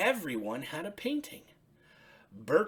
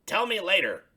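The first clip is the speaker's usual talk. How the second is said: higher, louder friendly Bert; 8 semitones higher, 10 dB louder than usual. Acted. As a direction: voice Bert lifted up high. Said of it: "Bert said".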